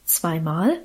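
low, drawn-out astonished "Zweimal?"; astonished question. A narrator's reading. Farm wife questioning the boy's two dryings, astonished. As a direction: repeat it in disbelief. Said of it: "fragte die Frau erstaunt".

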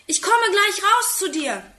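high-pitched call down to the street. A narrator's reading. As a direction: call down eagerly to a friend. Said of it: "ruft er aus dem Fenster".

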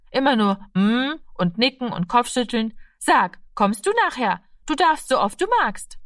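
a hint of an eager child, pitch lifting; Mädchen aus der Geschichte. (leicht angedeutete lebhafte Mädchenstimme)

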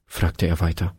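ordinary delivery, nothing marked

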